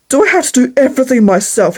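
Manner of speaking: plain reading voice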